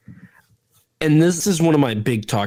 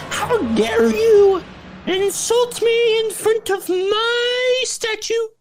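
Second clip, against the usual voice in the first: angry goddess. Retold or imitated. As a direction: ham up the offended goddess. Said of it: "she's like".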